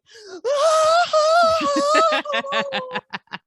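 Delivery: high, loud hammed-up wail; laughing while imitating a fake cry; mock fake crying. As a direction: fake sobbing through laughter. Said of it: "And she's like".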